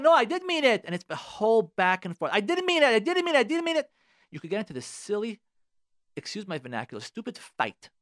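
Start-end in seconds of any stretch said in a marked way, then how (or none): 0.0-0.8 defensive wife. Retold or imitated. Wife lies in acted quarrel. 2.3-3.9 high, loud mock denials; much higher, louder imitation of a denying wife. Back-and-forth quarrel.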